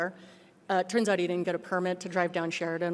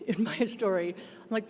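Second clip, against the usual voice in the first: ordinary talk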